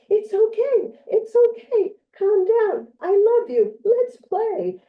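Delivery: mock the nanny's high, loud cooing; imitating the nanny. Mimicking the nanny soothing an upset boy, high and loud. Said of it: "she just gets more like".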